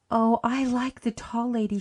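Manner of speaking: put-on girl in tears, gasping to the matron; gasped out. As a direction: blurt it out on a gasp. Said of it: "she gasped".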